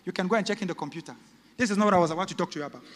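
usual speaking voice

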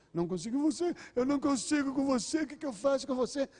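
high-pitched exasperated mock yell; hammed-up cry of defeat, rising high; shouting helpless parent. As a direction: act out a parent losing control. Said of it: "Quem grita está dizendo".

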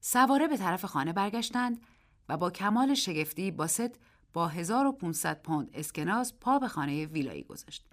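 ordinary delivery, nothing marked

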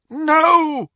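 loud, high wailed no; anguished cry. Acted. Dreamer crying out as he relives his loss, anguished. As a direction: cry out as if from a nightmare.

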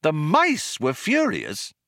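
baffled, drawn-out query; incredulous echo. Acted. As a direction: echo it back in utter disbelief. Arthur echoes in disbelief.

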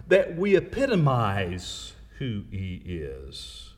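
ordinary talk

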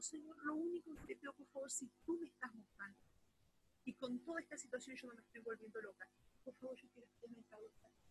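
soft retold plea to the Lord; hushed prayer. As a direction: pray it barely above a breath.

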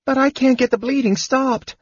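high-pitched kender protest; hurt tone. A narrator's reading. Kender tending bleeding knight, hurt tone. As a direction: plead it in a very high, injured voice. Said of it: "replied in a hurt tone".

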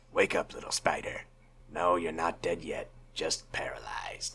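low whispered menace, slow; Green Goblin impression. Friend showing the Goblin line, low and whispered. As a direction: hiss it low like a gloating villain. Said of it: "he says it like this".

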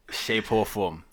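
ordinary delivery

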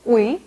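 오이 repeated without any k; southern Vietnamese reading. Shown as a demonstration. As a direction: say 오이 starting on the vowel. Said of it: "남부에서는".

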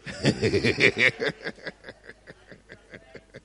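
long, breathy real laugh. Real, not acted. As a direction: laugh on and on until it trails away. Preacher laughing with congregation.

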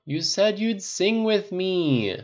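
slow, high, drawn-out plea; whining. A narrator's reading. Pepper nagging Tony to sing, whining. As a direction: complain like a wheedling drunk. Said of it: "Pepper whined".

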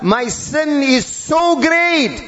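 high, slow, stretched lament; anguished self-reproach. Acted. Sinner speaking to himself, anguished self-reproach. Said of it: "he says to himself".

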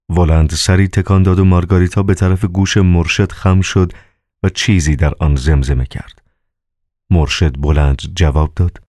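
usual speaking voice

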